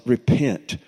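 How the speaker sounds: mild mock weeping, dying off to near silence; retold sobbing. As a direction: echo her sobbing plea, trailing away. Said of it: "in just her sobs".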